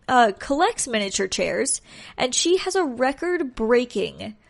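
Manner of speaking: ordinary delivery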